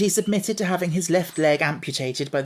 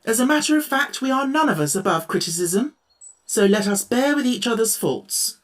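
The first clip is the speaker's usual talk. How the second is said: higher, louder anxious pleading; anxious plea; 8 semitones higher, 4 dB louder than usual. A narrator's reading. Tin Woodman pleading with the quarrelling friends, anxious. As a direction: beg them to stop. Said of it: "pleaded the Tin Woodman anxiously".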